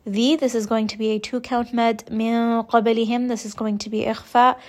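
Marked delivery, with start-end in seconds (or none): none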